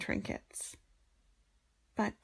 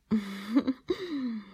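teasing laugh; 3 semitones higher, 3 dB louder than usual. Acted.

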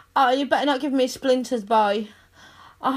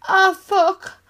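high, loud ecstatic cry; loud pleasure cry; 9 semitones higher, 8 dB louder than usual. Acted. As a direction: cry out at the peak.

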